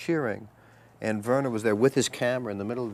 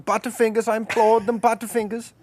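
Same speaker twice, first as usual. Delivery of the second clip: high, loud mock director lament; hammed-up, high-pitched pleading complaint; Werner Herzog; 12 semitones higher, 6 dB louder than usual. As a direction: imitate an accented director obsessing alone. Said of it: "Herzog sitting alone on a little bench".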